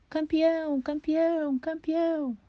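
high, loud chant of a champion; triumphant yell. A narrator's reading. Afonso raises the rooster's wing, yelling champion. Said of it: "Levantou a asa do terrível e berrou".

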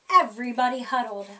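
ordinary delivery

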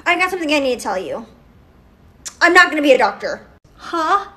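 ordinary talk

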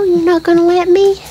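high playful tease; high teasing. Real, not acted. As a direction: tease the pet in a lifted voice. Teasing the pet.

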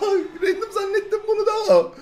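high laugh through the words. Real, not acted. Gamer laughing at his own mistake, giggling.

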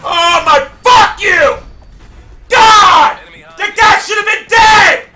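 high, loud real outburst; furious yelling. Real, not acted. Gamer exploding after an unfair death, furious yelling. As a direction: explode at the screen. Raging after dying to enemy.

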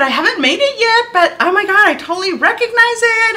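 high, loud fangirl gush; geeking out. Retold or imitated. Fan answering the designer, geeking out. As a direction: gush back at her, pitched right up. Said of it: "I started geeking out".

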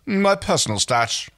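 curt. Acted. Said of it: "Rigel answered curtly".

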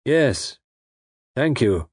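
lightly voiced unseen villain; a hint of a polite, unseen villain; disembodied villain voice. A narrator's reading. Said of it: "a voice that seemed to come from the empty air".

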